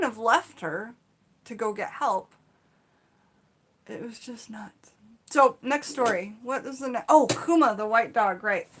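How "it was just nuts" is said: breathless whisper. Real, not acted. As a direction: drop to a hush, still shaken. Recalling the scary moment.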